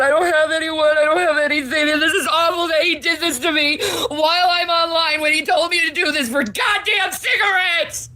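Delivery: sobbing out the complaint; crying, pushed up into a near shout at the end; real crying. Real, not acted. Crying over partner online.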